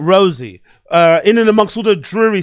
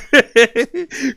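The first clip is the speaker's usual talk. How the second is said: amused laugh; 6 semitones higher than usual. Real, not acted. Laugh at journey idea.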